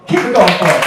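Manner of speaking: loud emcee push for applause. Real, not acted. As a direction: pump up the applause.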